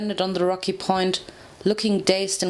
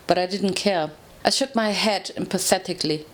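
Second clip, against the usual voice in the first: ordinary delivery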